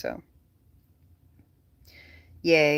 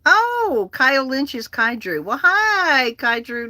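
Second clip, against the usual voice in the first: delighted surprise; 7 semitones higher, 5 dB louder, slower than usual. Real, not acted. Recognises viewer in chat.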